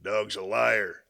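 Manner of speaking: very slow, flat-voiced put-down of "Dog's a liar"; deadpan punchline. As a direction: drop the punchline dry and unimpressed. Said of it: "The other guy goes".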